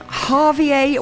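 ordinary delivery

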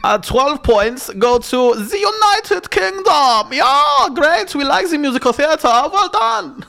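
high, drawn-out German spokesperson voice; comic high-pitched German accent. Acted. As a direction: do a hammy German announcing Eurovision points. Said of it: "That's a really good German accent".

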